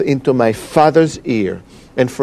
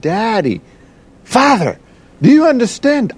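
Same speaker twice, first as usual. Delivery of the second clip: rising to a high, insistent appeal; retold urgent plea; 9 semitones higher than usual. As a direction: act out pressing your father, more intensely each time. Acts out pressing his father.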